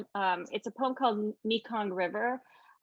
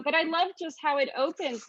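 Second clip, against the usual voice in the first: ordinary talk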